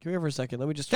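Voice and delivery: ordinary talk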